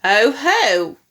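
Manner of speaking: loud gloating exclamation; crying out. A narrator's reading. Grandma catching the boy out, loud exclamation. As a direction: pounce on it as if catching someone out. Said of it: "she cried".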